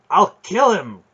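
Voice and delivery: high, loud burst of fury; enraged vow. A narrator's reading. Boy raging inwardly at his enemy, furious. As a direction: explode with murderous rage. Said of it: "Garion said silently in the vaults of his brain".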